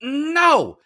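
high, loud, drawn-out mad yell; mock-angry shouted outburst. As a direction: imitate a comic losing his temper. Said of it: "with fingers and all and getting mad".

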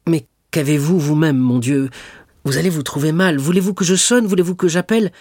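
high, loud, urgent worry; alarmed concern. A narrator's reading. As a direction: press anxious questions, pitch climbing. Dantès alarmed, offers to help. (inquiet, affolé, la voix qui monte)

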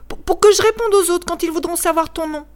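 put-on boy asking his new father's name; eager child. A narrator's reading. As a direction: ask like a small eager boy. Child explains why he asks.

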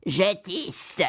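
lightly voiced gruff cat answer; a hint of a cat putting on a big voice; understated gruff cat reply. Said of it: "répondit le chat d'une grosse voix".

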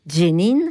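Jenin begun with D plus J, "djé-nine"; local DJ sound. Shown as a demonstration. Letting the ear catch it, Jenin with DJ onset. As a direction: put an audible D before the J of Jenin. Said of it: "Vous avez un D J là qui arrive".

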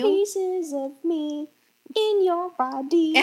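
slow, high, solemn prophet voice; hammed-up holy proclamation; mock Jesus voice.

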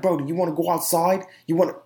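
high-pitched imitation of a man squaring up; mock challenge. Short man challenging another to step outside, mock challenge. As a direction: mimic someone picking a fight, pitched well up.

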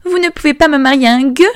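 high, loud, fast indignant protest; princess protesting. Acted. Princess refusing to marry a beggar, high fast indignant cry. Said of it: "protesta la princesse".

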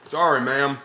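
put-on American Southern accent. Actor playing an American answering a ma'am, in a Southern accent. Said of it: "putting on a Southern accent".

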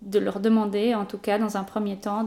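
usual speaking voice

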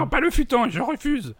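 put-on high, loud drunken wail; hammed-up, outraged refusal; stubborn protest. Ivrogne refuse de coopérer. (refus outré, crié dans l'aigu)

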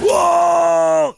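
high, loud shouted wow; excited wow. Shown as a demonstration. Co-host demonstrating the twist-question reaction with a loud wow. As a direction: yell it like a big surprise. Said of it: "C'est ainsi qu'il faut réagir".

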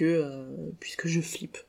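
slow, breathy whisper, barely voiced; whispered confession. Real, not acted. Podcaster admitting her fear to listeners, whispered slowly. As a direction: confide the fear in a slow hush. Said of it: "whispered confession after plain take".

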